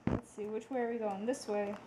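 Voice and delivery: hushed and soft; quiet. Real, not acted. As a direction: drop to a hush. Quiet page turning.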